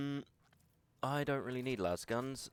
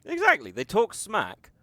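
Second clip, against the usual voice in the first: mock indignation; 7 semitones higher, 7 dB louder, slower than usual.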